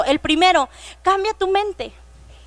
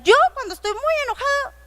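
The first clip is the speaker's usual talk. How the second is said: put-on higher, childlike voice; imitating a child; 6 semitones higher than usual. Child answering the teacher about getting angry, childlike voice. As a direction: play a little kid owning up. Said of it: "varios empezaron a contestar".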